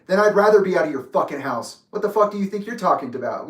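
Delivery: heated parting retort; matching his energy. Retold or imitated. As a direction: fire back indignantly. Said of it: "I was raised on that energy".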